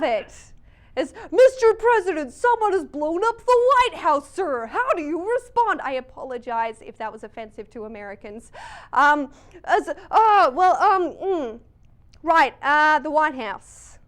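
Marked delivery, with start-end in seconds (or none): 1.3-5.8 high, loud American reporter voice; booming American accent at a press briefing. Pretend. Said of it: "in this accent". 8.9-14.0 hesitant Aussie mumbles and grunts; Australian accent, fumbling a crisis reply; bumbling Australian president. Pretend. Plays Aussie president floundering.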